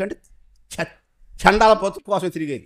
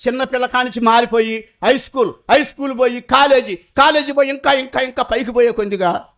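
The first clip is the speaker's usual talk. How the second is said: ordinary talk, nothing marked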